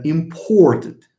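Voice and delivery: important with a lengthened, heavy o, said slowly; slow stressed demo. Shown as a demonstration. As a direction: draw out the o in important. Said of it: "the nucleus of the syllable".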